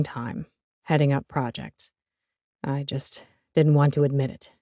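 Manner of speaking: a lightly voiced older father. Stan, the father speaks.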